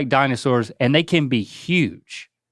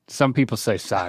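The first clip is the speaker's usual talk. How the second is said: usual speaking voice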